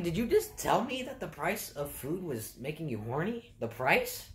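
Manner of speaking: can't believe what he just said; stunned mock outrage; incredulous. Incredulous retelling of weird customer.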